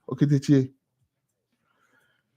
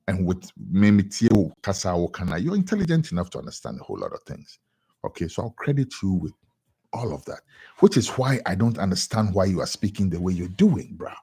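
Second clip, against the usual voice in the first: ordinary delivery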